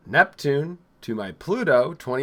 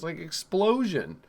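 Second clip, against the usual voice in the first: ordinary delivery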